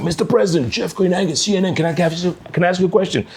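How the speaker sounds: high, eager reporter shout across the room; eager call-out. Retold or imitated. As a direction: pitch your voice up and push to be heard. Reporter calls out at press conference.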